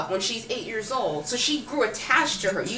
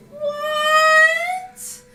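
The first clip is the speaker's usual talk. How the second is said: a much higher, louder disbelieving squeak; baffled what; 8 dB louder than usual. Real, not acted. Reviewer baffled by the nanny reveal, squeaking in disbelief. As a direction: shriek the word in utter disbelief.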